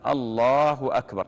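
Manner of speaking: slow, full "الله أكبر"; demonstrated takbir. Shown as a demonstration. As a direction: call "الله أكبر" as if opening prayer. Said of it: "أنت الآن قلت هكذا".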